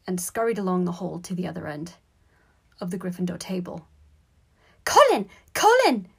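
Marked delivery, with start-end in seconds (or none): 4.8-6.1 high, loud squeaky call; excited squeak. A narrator's reading. Boy calling his brother across the hall, high squeak. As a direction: squeal the name out sharply. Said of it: "he squeaked".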